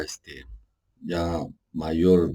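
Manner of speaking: normal talking voice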